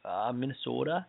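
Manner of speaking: a light Minnesota accent on the city name; a quiet, lightly put-on Minnesota accent. Shown as a demonstration. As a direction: stretch out "Minnesota" the way a Minnesotan would. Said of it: "Can you do the accent again?".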